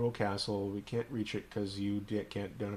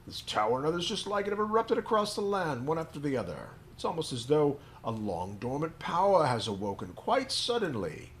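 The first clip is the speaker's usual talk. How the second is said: put-on higher storyteller old man; 7 semitones higher, 4 dB louder than usual.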